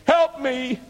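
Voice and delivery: retold scream. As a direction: cry out help me high and desperate. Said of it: "And I screamed".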